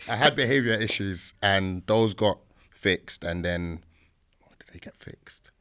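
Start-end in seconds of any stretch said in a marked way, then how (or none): 4.4-5.5 whispered aside. Real, not acted. Aside to himself mid-story.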